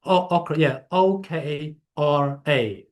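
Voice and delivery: O K R A spelled slowly letter by letter. Shown as a demonstration. Answering a caller's question, spells O K R A slowly. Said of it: "You said okra or okra?".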